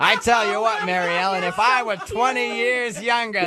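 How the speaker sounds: high, slow teasing croon; mock smitten older suitor. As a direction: draw it out as a lovestruck older admirer, hammed up. Host mock-voices the older suitor.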